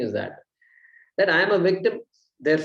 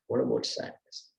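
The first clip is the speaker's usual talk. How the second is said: whispered question; 13 semitones lower, 6 dB quieter than usual. Shown as a demonstration. Teacher musing Swamiji's question, in a whisper. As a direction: breathe the question to yourself. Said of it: "Swamiji makes a point".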